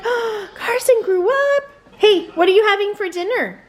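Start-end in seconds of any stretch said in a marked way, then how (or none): 0.0-1.6 high, slow gushing gasp. Retold or imitated. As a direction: imitate friends gasping in delight. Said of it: "and they were like".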